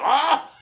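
short high, loud "ah"; sudden high-pitched mock yell; mock outcry. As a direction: yelp like outraged buddies. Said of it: "buddies be like".

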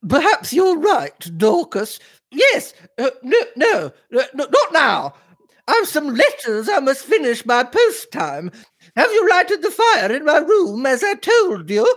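put-on very high, dithering older lady; high-pitched imperious mistress, fussing; flustered lady of the house. A narrator's reading. Mistress answers her parlour maid, high and flustered.

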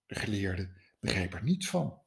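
ordinary delivery

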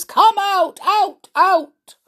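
high, loud, ringing repeats; repeating echo. A narrator's reading. Echoes repeat through the dungeons, high and ringing. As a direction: bounce the word back high, over and over. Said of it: "whispered the echoes".

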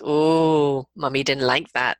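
low, firm parental displeasure; deep warning 'oh' from mother; disapproving mummy tone. Shown as a demonstration. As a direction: let the child hear Mummy is displeased. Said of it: "That tone of voice is there".

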